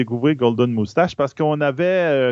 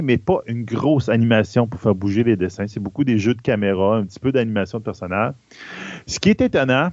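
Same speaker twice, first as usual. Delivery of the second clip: ordinary delivery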